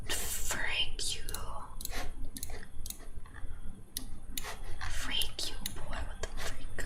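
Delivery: hissed whisper at the screen; whispered curse. Real, not acted. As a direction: mutter it under your breath in a whisper. Cursing at the game.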